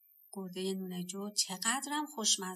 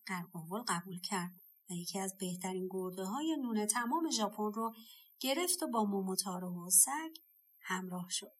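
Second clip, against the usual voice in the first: ordinary delivery, nothing marked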